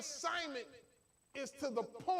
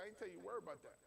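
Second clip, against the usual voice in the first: softer, firm divine reply; God's voice; 5 semitones lower, 11 dB quieter, much faster than usual. Retold or imitated. Preacher quoting God's rebuke, soft and firm. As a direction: drop the volume and speak as God correcting him. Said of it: "God said".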